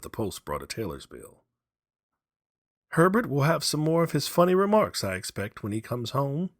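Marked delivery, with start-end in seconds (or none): 2.9-6.5 higher, louder scoffing wife; old mother scoffing at her husband, higher; lightly voiced old wife. A narrator's reading. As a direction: brush off the soldier's tales. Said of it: "she said as they sat at dinner".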